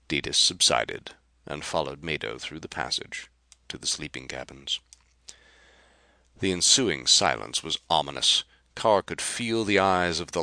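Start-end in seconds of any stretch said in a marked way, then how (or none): none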